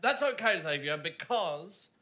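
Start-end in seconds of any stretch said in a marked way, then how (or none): none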